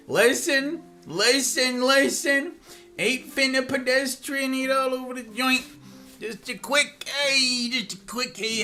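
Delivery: high, far goofy voice; goofy slang voice. Pretend. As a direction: play a slangy character. Slangy put-on rant.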